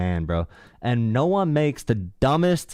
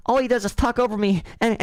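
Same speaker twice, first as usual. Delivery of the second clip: high whiny mock voice; mock whiny caller; 10 semitones higher than usual. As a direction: squeak it like a sulking caller. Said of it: "mocking the caller".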